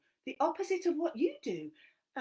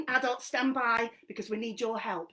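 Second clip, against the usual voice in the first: ordinary delivery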